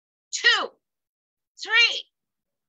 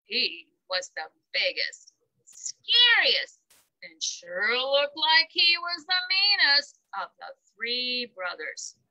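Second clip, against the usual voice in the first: usual speaking voice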